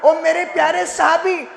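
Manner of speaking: normal talking voice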